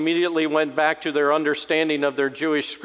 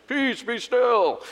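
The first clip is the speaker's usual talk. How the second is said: high, slow, hammed-up Hollywood proclamation; big dramatic; 8 semitones higher, slower than usual. Hollywood Jesus stands over the storm, grandly dramatic. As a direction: boom it theatrically, drawing out each word. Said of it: "we imagine this big dramatic thing".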